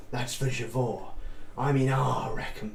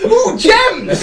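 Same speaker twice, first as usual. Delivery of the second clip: much higher, louder laughing ooh; excited squeal; 14 dB louder than usual. Real, not acted. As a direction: squeal with delight at treasure. Compass points, laughing.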